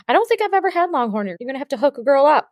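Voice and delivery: excited. Real, not acted. Podcast host begging guest for steak, eager. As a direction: beg playfully for a taste.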